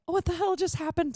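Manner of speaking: quick, high-pitched startled reaction; retold shock. Driver reenacting the moment after the crash, startled. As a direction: act out sudden bewilderment, pitched high. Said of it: "it went from a quick, like".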